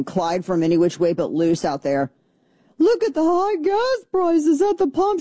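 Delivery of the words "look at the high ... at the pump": very high-pitched mocking screech; Marjorie Taylor Greene. Radio host mimicking a video about gas prices, high mocking caricature. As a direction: send up a complainer in a shrieky caricature. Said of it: "you have Marjorie Taylor Greene".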